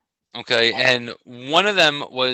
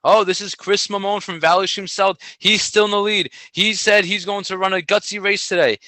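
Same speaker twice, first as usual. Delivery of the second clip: higher-pitched announcer on the microphone; imitated track announcer, pitched up; race announcer; 6 semitones higher than usual. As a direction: call the race over the PA. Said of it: "Type in a British accent".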